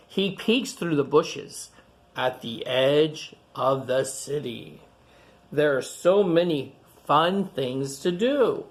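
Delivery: ordinary delivery, nothing marked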